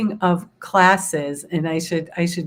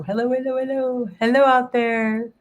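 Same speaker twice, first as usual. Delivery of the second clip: cheerful hello; 6 semitones higher than usual. Real, not acted. Greeting viewers back cheerfully.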